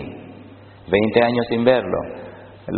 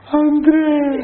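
much higher, louder shout of recognition; imitated joyful yell; 5 dB louder than usual. Pastor imitating Leo shouting his name on recognizing him, much higher and louder. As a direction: burst out with the name in delighted disbelief. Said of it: "va a pegar un grito".

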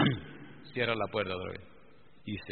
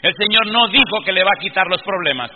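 loud, high fervent sermon; preaching at full force; 7 semitones higher, 14 dB louder than usual. Acted. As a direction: preach it to the whole congregation.

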